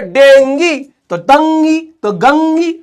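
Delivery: डेंगी, दंगी, गंगी stretched with matching ee endings; mocking drawn-out series, each of डेंगी, दंगी, गंगी ending in ee; mock-logical rhyming. Shown as a demonstration. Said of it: "इधर कोई logic ही नहीं है".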